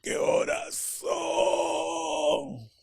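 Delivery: high, very slow strained voice with no breath; ghostly drawn-out whisper-shout; breathless rasp. Retold or imitated. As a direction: squeeze it out with empty lungs. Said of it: "mi voz salió así".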